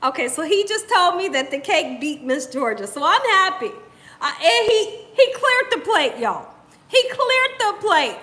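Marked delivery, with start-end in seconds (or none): none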